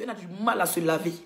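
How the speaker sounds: ordinary delivery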